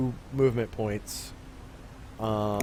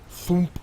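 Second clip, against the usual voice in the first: a sharp higher thump like a launcher firing; grenade launcher thump; 6 semitones higher than usual. Retold or imitated. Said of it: "that's my grenade launcher impression".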